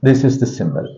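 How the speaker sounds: normal talking voice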